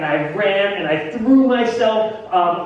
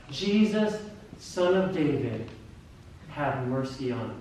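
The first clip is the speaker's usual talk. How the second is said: humble plea; 4 semitones lower, 7 dB quieter than usual. Acted. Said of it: "approaching Christ with humility".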